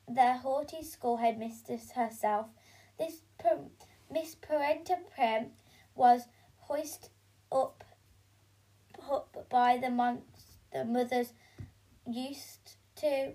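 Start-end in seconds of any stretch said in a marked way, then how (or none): none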